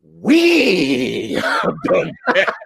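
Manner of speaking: slow, lightly voiced wooden line, breaking into laughter; mock De Niro. De Niro denying it to the parents, wooden mock line. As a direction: imitate a bad, flat take while cracking up. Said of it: "And, and De Niro goes".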